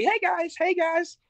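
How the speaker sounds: tiny high falsetto hello; mock-cheerful squeaky sing-out; cheery greeting. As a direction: imitate yourself prancing in, way up high. Acting out their cheery entrance.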